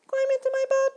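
very high, loud squeaky little mosquito; a tiny self-important ferryman insisting on rules. A narrator's reading. Mosquito ferryman lecturing Grasshopper, very high and loud.

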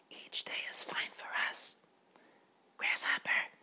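hushed, breathy little butterfly voice; whispering butterfly. Acted.

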